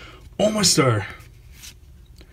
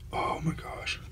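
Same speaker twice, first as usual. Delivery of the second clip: breathless whisper of disbelief; whispered gasp; 15 dB quieter, slower than usual. Retold or imitated. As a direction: whisper it like you can't believe it. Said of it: "I'm like".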